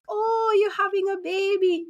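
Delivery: high, excited exclaiming stranger; light up at the bump; delighted stranger. Retold or imitated. South African stranger noticing her pregnant belly, high and thrilled.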